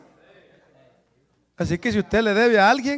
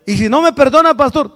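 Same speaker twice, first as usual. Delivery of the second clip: mock objecting congregant; 5 semitones higher, 8 dB louder than usual. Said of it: "Pide perdón".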